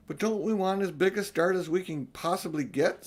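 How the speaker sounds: put-on high-pitched urgent boy; lightly voiced eager boy; eager Peter. A narrator's reading. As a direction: push to leave at once.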